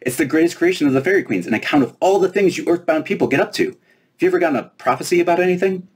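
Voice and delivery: put-on high, bright-eyed fairy girl, teasing. Fairy princess quizzing the giant girl, high and playful.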